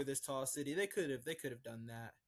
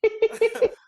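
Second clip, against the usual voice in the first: very loud, high-pitched burst of laughter; 20 dB louder than usual. Real, not acted. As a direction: cackle out loud in quick bursts.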